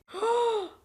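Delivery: sharp, high gasp; sudden audible intake of breath; real gasp of alarm; shocked gasp. Real, not acted. Shocked at the film.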